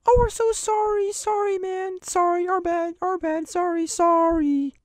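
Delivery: very high squeaky apologies; groveling apology. Retold or imitated. Students apologizing to the instructor, high squeaky groveling. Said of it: "rushed over to him".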